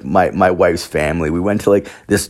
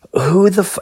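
outraged disbelief; 13 semitones higher than usual. Retold or imitated. Outraged quote of a scam.

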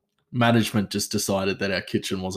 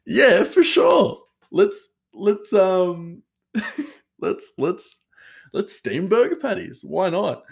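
high, mock-eager sarcasm; sarcastic agreement; 10 semitones higher, 4 dB louder, slower than usual. Sarcastically agreeing with the chef's steaming idea. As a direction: oversell it, tongue in cheek. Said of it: "I said jokingly, sarcastically".

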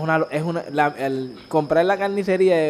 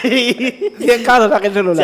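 laughing; 4 semitones higher than usual. Acted. S1 laughs while repeating advice.

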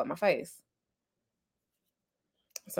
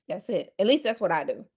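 ordinary talk

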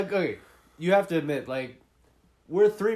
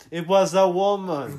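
loud, higher hammed-up film actor; Tommy Wiseau; 4 semitones higher, 7 dB louder than usual. Imagining the actor sniffing out the culprit, hammed-up impression. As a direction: overact a grand deduction. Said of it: "Tommy Wiseau would make this movie".

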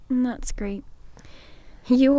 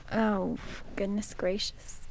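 whisper; 8 dB quieter, slower than usual. Real, not acted. Bracing to hear old story.

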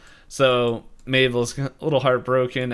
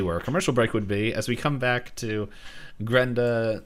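ordinary talk

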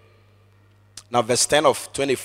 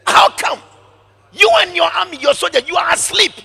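loud, high scolding shout; angry rebuke; 14 semitones higher, 7 dB louder than usual. Acted. David shouting at Abner across the valley, angry. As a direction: scold the careless guard.

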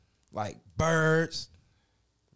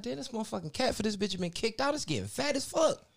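mock amazement; 10 semitones higher than usual. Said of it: "I'm like".

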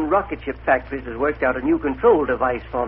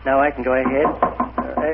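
usual speaking voice

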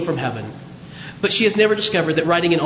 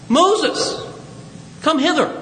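high-pitched summoning call; 7 semitones higher, slower than usual. Acted. God calling Moses to come, high summoning call. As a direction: call someone over from a distance. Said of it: "It's also a call".

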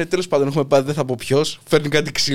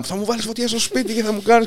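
mock yelling; 7 semitones higher than usual. Retelling a homeowner's outburst over a fire, mock yelling. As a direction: imitate an irate man's yelling, voice pitched up. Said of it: "Και ουρλιάζει και να φωνάζει".

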